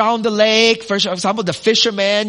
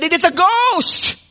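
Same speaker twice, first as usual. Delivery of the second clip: higher mock frightened cry of the disciples; fearful cry; 9 semitones higher, 3 dB louder than usual. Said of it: "they cried out in fear".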